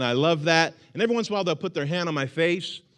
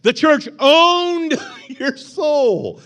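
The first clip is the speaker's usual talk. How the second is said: very slow, high, loud disbelief through laughter; incredulous laughing shout; 12 semitones higher, 6 dB louder, much slower than usual. Acted. Preacher repeats the claim in loud, laughing disbelief. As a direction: cry it out, half laughing.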